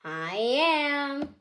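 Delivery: plain reading voice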